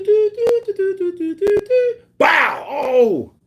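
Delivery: high rapid-fire punch noises bursting into a loud bow; imitated punch flurry. Boxer working the body till he drops, imitated punch flurry.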